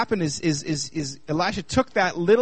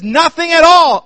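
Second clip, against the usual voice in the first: higher, louder, slower despairing cry; dramatic widow; 10 semitones higher, 12 dB louder, slower than usual. Retold or imitated. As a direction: wail it out like the poor widow. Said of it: "She says".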